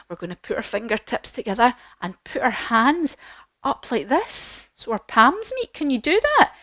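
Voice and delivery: ordinary delivery, nothing marked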